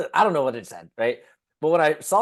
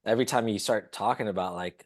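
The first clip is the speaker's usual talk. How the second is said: ordinary delivery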